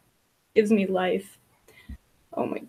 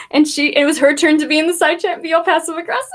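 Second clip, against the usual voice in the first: ordinary delivery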